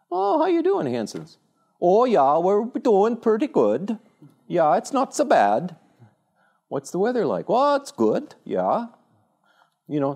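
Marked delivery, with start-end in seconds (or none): 0.1-1.4 high-pitched, hammed-up neighborly greeting; porch caller. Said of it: "They say". 1.8-5.8 high, loud, drawn-out folksy reply; small-town porch neighbor. Acted. 6.7-8.9 high-pitched mock neighborly chat; porch small talk.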